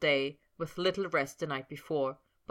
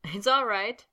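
bright, high and louder; 5 semitones higher, 6 dB louder than usual. A narrator's reading. Potter brightly reassures Sabrina, bright and loud. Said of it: "Potter said brightly".

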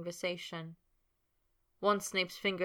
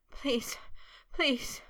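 panting plea; 8 semitones higher than usual. A narrator's reading. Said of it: "he panted in a chanting plea".

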